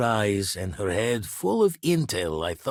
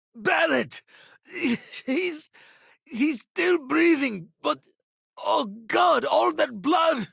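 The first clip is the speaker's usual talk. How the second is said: panicked cry; slower than usual. Acted. Crying out to Barrett on seeing all that blood, panicked. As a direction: cry out in horror, voice shooting up high. Cries out over wounded Barrett.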